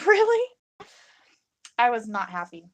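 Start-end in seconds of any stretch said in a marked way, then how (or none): 0.1-0.5 exasperated really. Retold or imitated. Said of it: "It's like".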